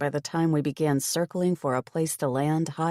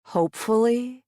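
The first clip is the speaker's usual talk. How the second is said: incredulous; 8 semitones higher, 3 dB louder than usual. A narrator's reading. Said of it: "his obtuse answers".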